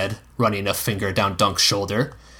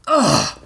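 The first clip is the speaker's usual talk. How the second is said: high, sudden pained ugh; raised-pitch pained cry; 7 semitones higher, 3 dB louder than usual. A narrator's reading. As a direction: yelp as if struck on a sore side. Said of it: "Dunk cried".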